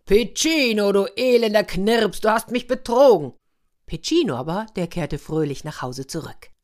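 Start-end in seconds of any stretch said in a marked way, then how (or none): 0.0-3.3 lauter, höherer Ausruf des betrogenen Orko; empört losbrüllen, wie ein Märchen-Unhold; wütendes Brüllen. A narrator's reading. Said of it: "da brüllte er". (wütend brüllend)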